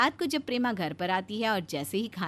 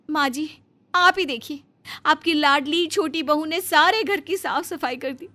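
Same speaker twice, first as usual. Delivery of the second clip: higher, louder, indignant complaint; tattling elder daughter-in-law; 8 semitones higher, 8 dB louder than usual. Acted. Elder bahu shows mother-in-law the messy room, indignant and higher. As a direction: egg the mother-in-law on, rising to a shrill peak.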